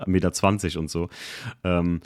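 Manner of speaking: ordinary talk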